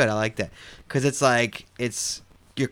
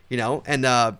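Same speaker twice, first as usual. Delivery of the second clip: ordinary talk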